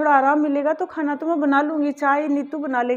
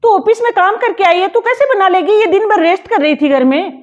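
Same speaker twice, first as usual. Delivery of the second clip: loud, higher protest; indignant; 5 semitones higher, 6 dB louder than usual. Acted. Mother-in-law snaps at the elder daughter-in-law in the younger one's defence, loud and indignant. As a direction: insist the younger one must not work.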